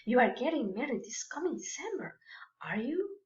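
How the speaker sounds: soft, whispery disbelief; quietly astonished, almost under the breath; hushed surprise. Acted. Friend reacting to a wedding announcement, hushed surprise. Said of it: "And you show surprise".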